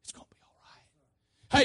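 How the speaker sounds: hushed, reassuring whisper; psst, then whisper comfort privately; breath-only, intimate reassurance; whisper to the spirit. Acted. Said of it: "when he speaks to your spirit".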